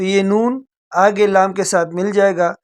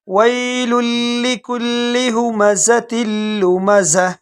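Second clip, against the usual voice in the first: Quran recitation; 2 semitones higher than usual. Shown as a demonstration. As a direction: recite it the way a teacher models it. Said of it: "جیسے میں پڑھ لیتا ہوں".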